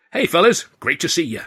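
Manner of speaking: loud, high, booming welcome; hearty greeting. A narrator's reading. Considine welcoming the comedians, booming hearty. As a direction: greet them like an old pal. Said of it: "shaking us warmly by the hand".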